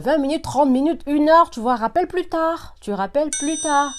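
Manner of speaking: real exasperation building up loud; exasperated outburst. Real, not acted. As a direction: throw up your hands and insist they call back later. Exasperated rant at repeat callers.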